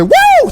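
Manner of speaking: high, loud "woo"; sudden hollered whoop; excited whoop. Retold or imitated. Excited Tom interrupts Oprah's question, loud whoop.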